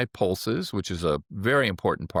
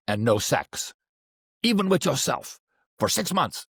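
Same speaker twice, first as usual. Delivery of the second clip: put-on a higher, wagging-finger warning in a Chinese accent; mimicking Chinese accent; 5 semitones higher than usual. Acupuncturist warns young patient in a Chinese accent. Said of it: "I'm gonna mimic his accent a little bit".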